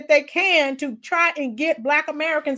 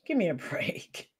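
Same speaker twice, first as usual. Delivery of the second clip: whisper; 5 semitones lower, 11 dB quieter than usual. Real, not acted. Host scoffing at the clip.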